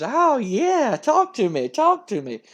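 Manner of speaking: swooping, hammed-up delight; over-the-top wrestler. Wrestler relishing his partner's tough talk, hammed-up and eager. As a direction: open high and croon it eagerly. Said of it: "And dude goes".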